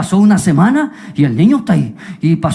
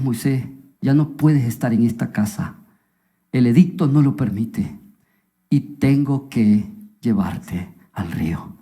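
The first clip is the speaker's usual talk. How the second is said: low, soft, halting farewell; acted mother's subdued grief; sorrowful goodbye; 8 semitones lower, 5 dB quieter than usual. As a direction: speak softly and low, letting the last words drag. Mother bids baby goodbye.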